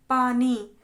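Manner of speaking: teacher sounding out पानी; example word said out. Shown as a demonstration. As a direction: call out पानी clearly for the class. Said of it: "जैसे हमने बोला".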